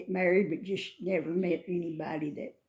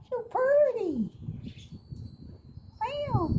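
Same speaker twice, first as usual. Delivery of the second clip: coaxing cats. Real, not acted. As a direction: coo and meow to them in falsetto. Coaxing cats to come and eat.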